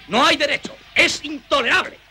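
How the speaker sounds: high-pitched outrage; indignant outburst. Acted. Don Ventura protesting the theft to the lodgers, indignant outburst. Indignant over stolen condensed milk.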